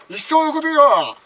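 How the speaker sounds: put-on loud, fast ranting old man; angry father ranting. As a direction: shout it like a furious dad. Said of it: "その時に親父が憤って".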